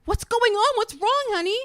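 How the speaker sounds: high, loud worried questions; alarmed husband, imitated. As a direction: play a husband rushing in, mock-frantic. Retold worried husband rushing in.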